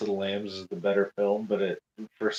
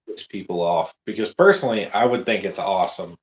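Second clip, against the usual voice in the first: ordinary talk, nothing marked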